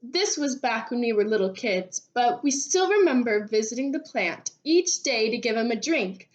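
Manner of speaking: plain reading voice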